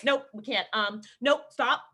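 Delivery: acting out short-tempered interruptions; short, snippy. Actor to husband mid-audition, short and stressed. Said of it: "I get really short".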